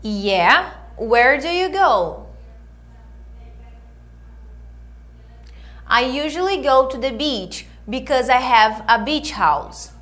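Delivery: clear, measured English lesson delivery; model dialogue reading. Acted. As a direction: read each line for them to repeat. Reads dialogue lines for students. (leitura de diálogo modelo)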